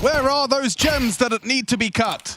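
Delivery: high, slow goofy movie voice; squeaky comedy line, drawn out; Adam Sandler impression. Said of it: "What about".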